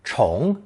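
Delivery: CHONG in a rising second tone. Shown as a demonstration. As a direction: let "chong" climb in pitch to the end. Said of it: "second tone".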